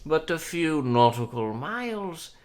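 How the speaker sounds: put-on high, refined old enchantress; gracious sorceress voice. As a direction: speak like a courtly sorceress. GM voices Madam Harple.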